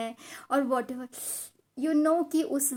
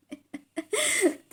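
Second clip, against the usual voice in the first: high-pitched amused laugh; 10 semitones higher than usual. Real, not acted. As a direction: burst of laughter at her own story. Laughs at her own refusal.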